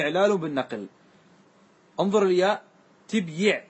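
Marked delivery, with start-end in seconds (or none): 3.1-3.6 تبيع said tib-yiʕ, the y carrying a short i; vowelled ya. Shown as a demonstration. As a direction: close تبيع on b, then say yiʕ. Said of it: "تحركت الياء". (تبيع بسكون الباء وتحريك الياء بالكسر)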